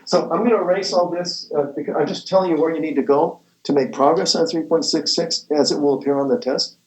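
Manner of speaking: ordinary talk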